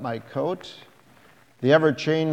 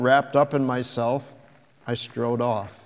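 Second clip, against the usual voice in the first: ordinary delivery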